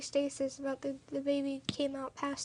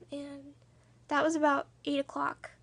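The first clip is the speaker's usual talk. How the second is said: usual speaking voice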